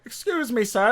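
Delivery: high-pitched mock-helpless call; pretend blind man. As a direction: play up a blind man politely stopping a stranger. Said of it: "[name] was pretending to be blind".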